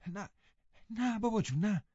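lightly voiced breathless girl; breathless denial. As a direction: gasp out the denial. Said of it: "نفس زنان گفت".

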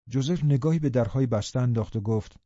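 usual speaking voice

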